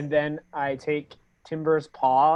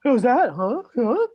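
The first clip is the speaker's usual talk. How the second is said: cooing at a pet; 7 semitones higher, 4 dB louder than usual. Retold or imitated. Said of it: "I go".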